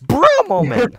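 high, loud shout. Real, not acted. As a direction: yell it out in delight. Laughing chaos shout.

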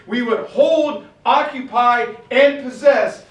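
loud, high, mounting tirade; furious shouting. Retold or imitated. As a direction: roar it, building to the top. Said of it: "he just blows his top".

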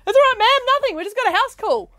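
mock cop voice. Cops reply, retold.

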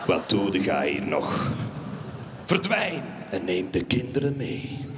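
deep slow mock brush-off; dismissive husband. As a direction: send her away coldly.

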